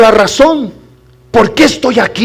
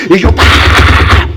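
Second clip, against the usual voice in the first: drawn-out cheer; 4 semitones lower, much slower than usual. Retold or imitated. Retelling his cheering at the game.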